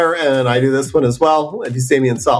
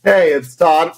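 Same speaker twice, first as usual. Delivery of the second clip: usual speaking voice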